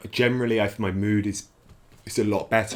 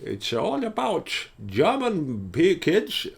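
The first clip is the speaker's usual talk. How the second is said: slow, higher mock German accent; 6 semitones higher, much slower than usual. Explaining the dark show in mock German accent. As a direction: drag it out in a put-on German accent. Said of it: "in this accent".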